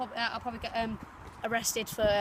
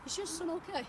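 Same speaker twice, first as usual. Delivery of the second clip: usual speaking voice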